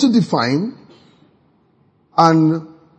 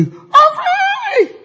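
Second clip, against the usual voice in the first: mock shout of freedom. Teen off to boarding school, whooping I'm free. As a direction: yell it like a kid let loose. Student leaving home, calling out.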